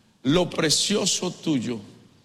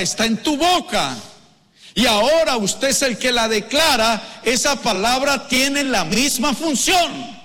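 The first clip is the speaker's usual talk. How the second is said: forceful sermon building to a high, held cry; loud, high pulpit exhortation; preaching; 7 semitones higher, 5 dB louder than usual. Real, not acted. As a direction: drive the point home to the congregation.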